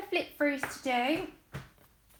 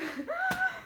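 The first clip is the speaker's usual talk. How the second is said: gasp; 5 dB quieter than usual. Real, not acted. Overwhelmed by the gift.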